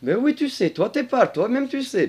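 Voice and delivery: high put-on voice, insisting; high-pitched know-it-all dragon; comic dragon character. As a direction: argue back in a high creature voice. Dragon figure argues with speaker.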